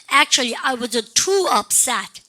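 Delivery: Actually, I was too upset spoken slowly, too stressed; slow drill. Shown as a demonstration. Teacher leading the class drill, slow and clear. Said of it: "Actually, 시작".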